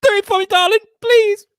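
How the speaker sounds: high, loud mock pleading; Lucy. Host mimicking co-host begging for the accent, high mock pleading. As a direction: beg in a hammed-up squeaky voice. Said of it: "I've had texts o-, off Lucy like".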